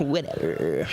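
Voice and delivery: slow, trailing mock whatever; dismissive comeback. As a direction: drag it out in a put-on sulk. Repeats the sulky comeback.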